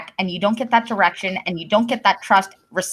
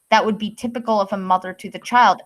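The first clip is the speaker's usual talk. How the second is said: normal talking voice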